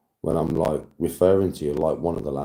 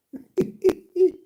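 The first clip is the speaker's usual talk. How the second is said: squeaky, very high laugh; high squeal of laughter; high giggle. Real, not acted. Still helpless with laughter.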